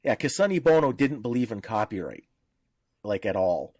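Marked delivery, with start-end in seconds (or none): none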